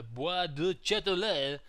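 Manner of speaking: put-on slow, hammy French accent on the place name. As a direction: say Bois de Châtelet like a bad French speaker. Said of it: "my worst French accent ever".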